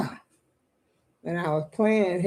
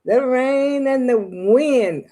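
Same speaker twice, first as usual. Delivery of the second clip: normal talking voice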